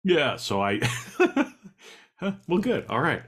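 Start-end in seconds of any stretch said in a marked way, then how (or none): 0.9-1.7 high burst of laughter; a real laugh at the joke. Real, not acted. Host hearing the employee quit, high burst of laughter.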